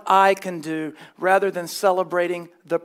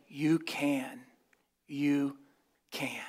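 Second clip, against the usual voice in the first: mock hushed whisper; 5 semitones lower, 11 dB quieter, much slower than usual. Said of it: "That's hard to do with straight face".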